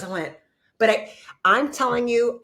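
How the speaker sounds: ordinary delivery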